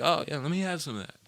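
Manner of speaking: eager Grandpa. Retold or imitated. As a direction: sound suddenly interested. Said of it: "he was like".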